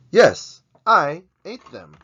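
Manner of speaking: loud, high proud confession; proud yell. Pretend. Proud confession of eating nuggets.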